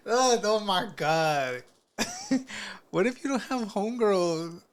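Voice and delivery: high, drawn-out mocking whine; hammed-up high-pitched fretting; mock distress. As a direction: wail the objection in a high voice, breaking into laughter. Mocking the objection while laughing.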